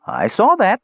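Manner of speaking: high-pitched, gleeful crow. A narrator's reading. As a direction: crow it triumphantly, voice leaping up. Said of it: "Klotnik crowed".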